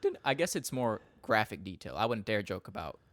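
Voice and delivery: normal talking voice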